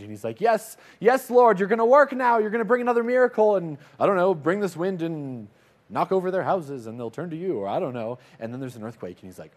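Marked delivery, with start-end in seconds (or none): 0.4-7.6 high, loud, breathless eagerness; bursting with hope that God will act; eager excitement. Acted. Elijah cheering on God's wind, high and excited.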